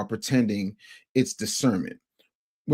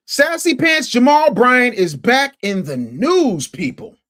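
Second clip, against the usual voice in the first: higher, louder, slow showman call; hyped announcement; 9 semitones higher, 7 dB louder, much slower than usual. Acted. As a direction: announce him like a ring entrance. Announcing gossip target to chat.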